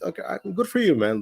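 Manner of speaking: mock congratulating, dripping with irony; sarcastic. Mocking a scammer's boast.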